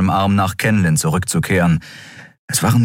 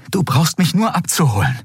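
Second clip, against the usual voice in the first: urgent reassurance; 10 semitones higher than usual. A narrator's reading. He calls out parting instructions.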